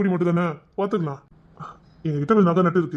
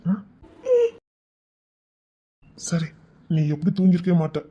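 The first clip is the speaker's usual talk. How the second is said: usual speaking voice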